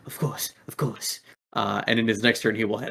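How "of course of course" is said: soft, quick agreement; a lightly voiced henchman's soft yes; goon agreeing. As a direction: murmur it dutifully. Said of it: "And the other guy goes".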